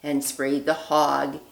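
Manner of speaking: put-on lively read-aloud voice for children; storybook reading. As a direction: read it like a bedtime story for little ones. Reads picture book aloud.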